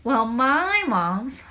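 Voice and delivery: loud, drawn-out interjection; jumping in. A narrator's reading. Lyle jumps into the complaints about parents, loud and aggrieved. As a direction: butt in high and loud, stretching the words. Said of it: "Lyle jumped in".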